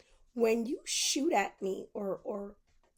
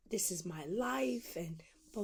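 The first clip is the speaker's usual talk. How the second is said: ordinary delivery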